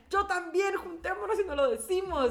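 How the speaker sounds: voz aguda y emocionada de niña; amiga emocionada. Retold or imitated. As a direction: contar el secreto muy entusiasmada. (tono agudo de amiga cómplice)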